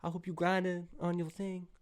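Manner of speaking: guy reading out his awkward texts to friends, high and sing-song; awkward friendly texts. Pretend. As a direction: gush at a friend you barely know how to text. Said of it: "I feel awkward when I text them".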